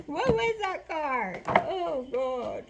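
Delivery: loud, high startled cry; shocked exclaim. Real, not acted. Reacting to the shark card on camera, a loud high startled cry. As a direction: blurt it out in surprise.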